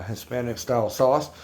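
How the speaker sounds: ordinary talk, nothing marked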